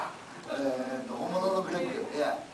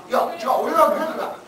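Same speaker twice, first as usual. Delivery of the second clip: laughing, fast and higher; playful insistence; 6 semitones higher, much faster than usual. Pretend. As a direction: insist you're Greg, joking. Playful argument over being Greg.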